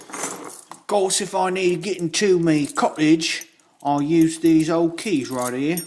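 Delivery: hammed-up rustic British voice, high and loud; mock old-country British accent, pitched well up; put-on British accent. Knife reviewer pretending to own a cottage, hammed-up British accent.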